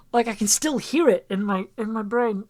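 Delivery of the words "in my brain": usual speaking voice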